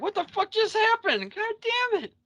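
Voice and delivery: very high mock shriek; Mabel's hammed-up falsetto panic; panicked squeal. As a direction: squeal it like a startled woman. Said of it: "Mabel's like".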